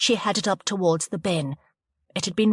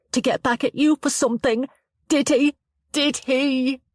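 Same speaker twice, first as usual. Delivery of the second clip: crying out; 8 semitones higher, 4 dB louder than usual. Acted. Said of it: "she cried".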